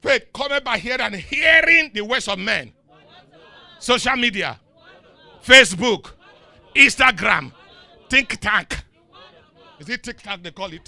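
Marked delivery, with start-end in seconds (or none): 0.0-8.8 loud, driving preacher's delivery; full-force preaching. Real, not acted. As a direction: preach it out loud with big drawn-out key words.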